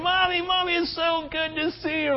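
put-on high, soft childlike squeal of joy; excited little child. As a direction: play the delighted little kid at the door. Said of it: "jump in your arms and say".

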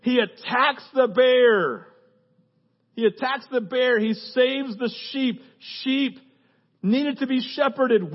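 loud, high, punched phrases; full-force preaching. Real, not acted. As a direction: drive each short line home to the crowd.

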